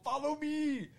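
high, drawn-out mock command; hammed-up heroic call; Arnold character voice. Slime calling others down the hole, Arnold impression. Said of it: "in that accent for that voice".

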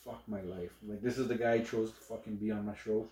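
very soft, low, defeated aside; quiet imitation of silent despair; imitating weary co-host. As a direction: say it under your breath, resigned. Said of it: "this guy probably just wants to go".

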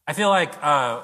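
ordinary talk, nothing marked

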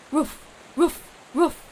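high, loud imitated barking; short sharp dog barks; dog woofs. A narrator's reading. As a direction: bark like an excited dog. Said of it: "Tripod gasped and wheezed".

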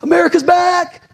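high, mock-triumphant shout; hammed-up gloating voice; mocking angry people. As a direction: parody the gloating crowd. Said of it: "angry people saying".

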